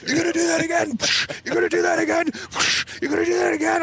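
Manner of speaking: high, strained mock-furious yelling; punishing dad. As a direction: hammer out each question like a dad mid-spanking, voice way up. Dad shouting while hitting with belt.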